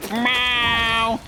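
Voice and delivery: high mock cat meow; bad meow. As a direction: meow like a cat, but it comes out like a moo. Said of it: "That's not a meow".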